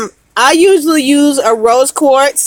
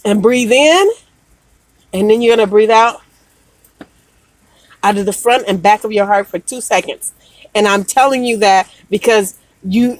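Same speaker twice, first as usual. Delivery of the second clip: guided breathing; 4 semitones lower than usual. Acted. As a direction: ease them through the breath, gently. Leads a heart breathing exercise.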